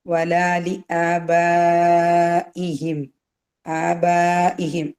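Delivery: slow, drawn-out chanted recitation; Quran recitation. Shown as a demonstration. Teacher reciting the verse for students to follow. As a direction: recite the verse slowly, melodic. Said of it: "recites the verse".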